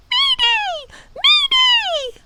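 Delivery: very high shrieking bird cry; screaming bird. A narrator's reading. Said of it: "she screamed".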